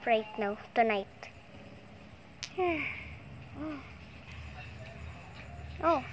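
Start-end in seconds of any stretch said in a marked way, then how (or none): 2.4-3.8 sleepy sigh. Pretend. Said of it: "Now I'm so sleepy".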